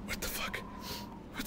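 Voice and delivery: breathless unvoiced whisper of disbelief; stunned whisper. Real, not acted. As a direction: whisper it in shock. Stunned whisper after death.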